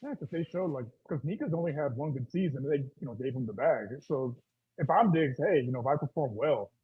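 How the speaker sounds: muffled voice, as if through a hand; words dulled and muffled. Real, not acted. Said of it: "Rios, you sound muffled".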